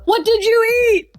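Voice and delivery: very high, drawn-out cry of disgust; hammed-up, high, stretched-out outrage; exasperated wail. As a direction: wail it slowly at the culprit. Scolding the farting dog.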